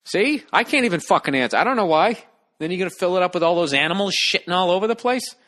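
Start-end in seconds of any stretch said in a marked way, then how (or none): none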